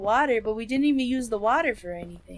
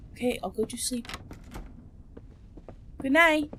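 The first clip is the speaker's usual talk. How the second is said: ordinary talk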